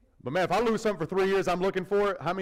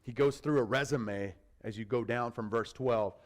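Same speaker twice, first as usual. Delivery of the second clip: normal talking voice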